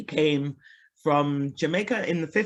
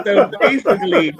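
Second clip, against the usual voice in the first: laughing; 10 semitones higher, 9 dB louder than usual. Real, not acted. Laughs at own confession.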